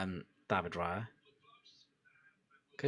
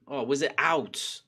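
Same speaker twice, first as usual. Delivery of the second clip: ordinary talk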